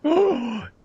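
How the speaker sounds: high, sharp gasp; thrilled gasp. Real, not acted. Streamer hears the dark plot reveal, thrilled gasp.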